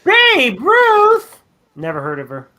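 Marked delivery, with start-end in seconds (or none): 0.0-1.4 high, loud yell of the name; hammed-up movie-quote shout. Co-host quotes The Sandlot after the homer story, hammed-up shout. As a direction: cry it out like a film line.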